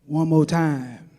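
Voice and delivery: low, slow, deep crooning voice. Retold or imitated.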